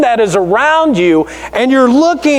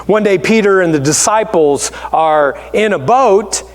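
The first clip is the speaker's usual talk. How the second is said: ordinary delivery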